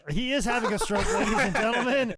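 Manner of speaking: loud laughing announcer call; mock announcer. To the co-hosts, laughing, a mock ring announcement.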